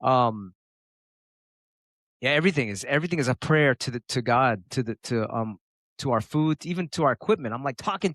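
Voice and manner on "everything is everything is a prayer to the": ordinary delivery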